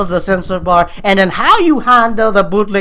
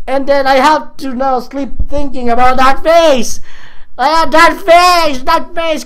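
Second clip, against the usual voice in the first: distressed; 6 semitones higher than usual. Real, not acted. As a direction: cry out in distress. Distressed exclamation.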